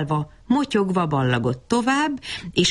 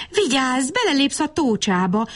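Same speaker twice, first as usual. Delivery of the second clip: mild storybook cry of caution; warning call; 9 semitones higher than usual. A narrator's reading. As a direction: call out a warning without real volume. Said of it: "akarta kiáltani Bab Berci".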